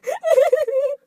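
high loud cry-laugh; shrieking laugh. Real, not acted. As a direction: wheeze in helpless laughter.

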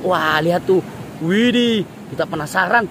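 ordinary delivery

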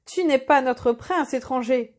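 usual speaking voice

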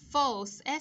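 "False" loud, with a clear s ending; final s sounded. Shown as a demonstration. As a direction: punch out "False" and release the final s. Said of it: "S ending sound".

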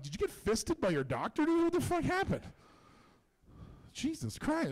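incredulous, pitch leaping up at the end; appalled, half-joking outburst; mild mock shock. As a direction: react in disbelief, voice climbing high. Acts out his shocked reaction to story.

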